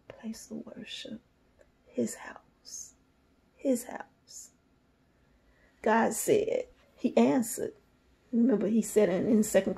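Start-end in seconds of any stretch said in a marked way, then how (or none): 0.1-4.5 whisper. Real, not acted. Hushed prayerful reading.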